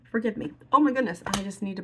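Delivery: teary apology to the viewers. Real, not acted. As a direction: apologise through tears. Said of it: "crying like a baby over here".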